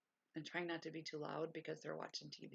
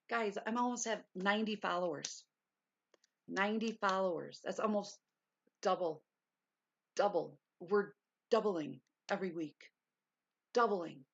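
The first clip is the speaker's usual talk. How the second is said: excited; 7 dB louder than usual. Real, not acted. Excited news of ninety followers.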